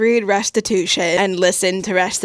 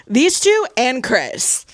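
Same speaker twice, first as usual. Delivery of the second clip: ordinary delivery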